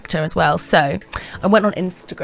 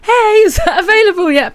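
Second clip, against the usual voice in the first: much higher, louder, bright friendly take; friendly, as meant; 7 dB louder, faster than usual. Shown as a demonstration. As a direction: chirp it to sound friendly. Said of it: "what I mean is".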